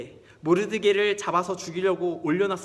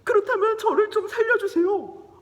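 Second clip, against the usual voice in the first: high, loud, wailing plea; hammed-up weeping queen; tearful pleading; 7 dB louder than usual. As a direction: beg for your life through acted sobs. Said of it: "에스더가 거기서 막 우는 거예요".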